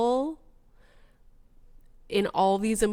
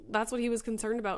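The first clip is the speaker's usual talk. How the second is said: ordinary talk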